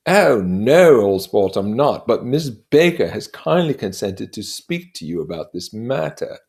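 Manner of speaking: lightly voiced proud storyteller, rising; boasting of medals, building up; Gadsby telling his war tale. Gadsby boasting to Nick of his war medals, proud.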